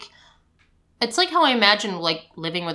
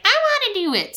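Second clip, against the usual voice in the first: put-on much higher little-kid demand; toddler insisting; much faster than usual. Mom imitating her daughter demanding to brush, very high. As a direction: whine it like a stubborn toddler.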